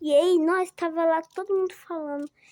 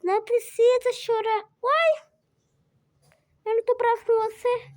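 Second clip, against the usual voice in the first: teacher reassuring; 6 semitones higher, 4 dB louder than usual. Retold or imitated. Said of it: "mas ela falou".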